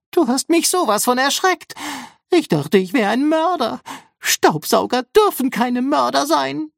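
very high, upset little robot; squeaky scolding. A narrator's reading. Rambo scolds after a fright, high upset squeal. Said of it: "schimpfte Rambo".

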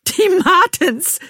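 very high, loud, drawn-out squeal; slightly hysterical. A narrator's reading. Narrator joining in with Bean, slightly hysterical. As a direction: squeal it slowly, on the edge of hysteria. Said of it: "stimme ich leicht hysterisch mit ein".

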